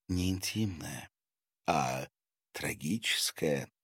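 soft, low, halting reply; subdued correction. A narrator's reading. Masha speaks of a tragic memory, subdued. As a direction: correct her quietly with pauses. Guest corrects, recalling tragedy.

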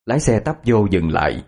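plain reading voice

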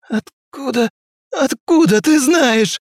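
high, slow moaning ghost voice. A narrator's reading. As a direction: moan it out in despair. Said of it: "простонал призрак".

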